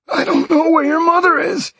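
high, breathless frightened man; gasping captive. Acted. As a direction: gasp it out in pain and fear. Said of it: "the man gasped".

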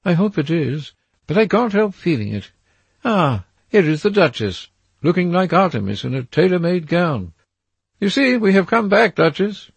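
put-on a nervous young man seeing a threat in the trees; frightened Dorian. A narrator's reading.

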